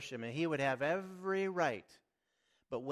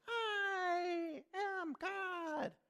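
very slow, high, wailing mock yell; hammed-up cry to the ocean, every word stretched; Shirley MacLaine; much slower than usual. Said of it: "she's yelling to the ocean".

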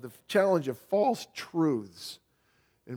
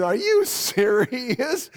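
slow, higher-pitched disbelief; mock astonishment, raised and drawn out; incredulous reaction; 7 semitones higher, much slower than usual. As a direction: drag out an incredulous question. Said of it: "You know, and I'm going".

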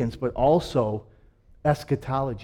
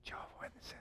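whispered aside; 28 dB quieter, faster than usual. Real, not acted. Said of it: "whispered aside".

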